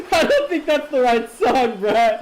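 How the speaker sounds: slow words through real laughter; amused, still laughing. Real, not acted. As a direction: giggle out the complaint at Brad. Laughing at wrong song.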